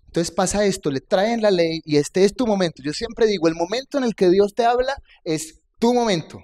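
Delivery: fervent preaching. Acted. Preacher urging congregation to answer when God speaks: fervent preaching. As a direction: rouse the congregation, it's your moment. Preacher rises to full force.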